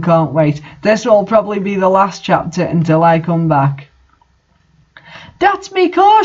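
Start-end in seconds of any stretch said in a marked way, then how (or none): 5.4-6.2 angry shout. A narrator's reading. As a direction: yell it at the car in a rage. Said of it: "shouted Draco angrily".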